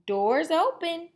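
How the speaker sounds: put-on high, slow old-lady voice; Granny voice. Granny inviting the visitor in, high and slow. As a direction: drawl it out like a granny.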